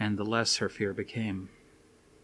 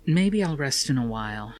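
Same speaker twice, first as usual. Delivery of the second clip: higher woman's voice; Suzanne's voice; 4 semitones higher, 4 dB louder than usual. A narrator's reading. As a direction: voice Suzanne, hesitant.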